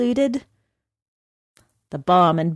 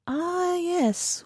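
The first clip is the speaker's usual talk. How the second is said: casual; 10 semitones higher, 6 dB quieter than usual. A narrator's reading. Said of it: "with a casual air".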